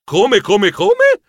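rising to a squeak of disbelief; disbelieving come. Acted. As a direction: sputter in shock, last one way up. Shocked at grandpa's message.

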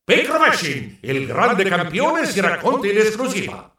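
slow, high newsboy proclamation; news announcer. Acted. Announcing champion's exclusive special edition, newscaster proclamation. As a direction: proclaim the headline, stretching every word.

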